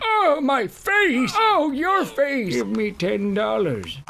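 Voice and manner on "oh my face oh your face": high, loud, slow wail; hammed-up cry of pain; mock pained shout. Comic pain outburst in game.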